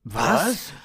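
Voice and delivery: loud, high astonished cry; incredulous exclamation; exclaiming in astonishment. A narrator's reading. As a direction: blurt it out, baffled. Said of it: "riefen Sven und Konstantin im Chor".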